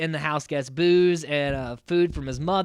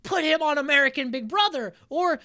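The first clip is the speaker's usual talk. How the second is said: retold excited pitch; 9 semitones higher than usual. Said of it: "I was like".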